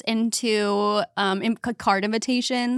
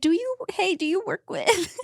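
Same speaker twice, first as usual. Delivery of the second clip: high put-on voice; 9 semitones higher than usual. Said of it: "being like".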